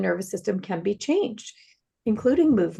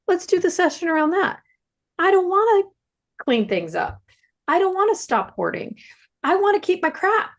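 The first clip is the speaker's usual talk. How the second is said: high-pitched, emphatic refusal; defiant refusal; 9 semitones higher, 3 dB louder than usual. Retold or imitated. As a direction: dig in stubbornly, pitched high. Therapist re-enacts defiant craving set.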